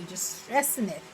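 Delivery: ordinary delivery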